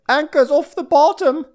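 loud high radio call; calling out the anchor like a deckhand on the radio; high mock radio call. Imitating captain on radio.

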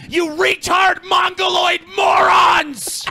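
slow, high-pitched furious shout; explosive ranting. Real, not acted. T J insults commenters over voice and shave, enraged shouting. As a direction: hammer out each insult, stretching the last. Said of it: "T J is shot out of a cannon".

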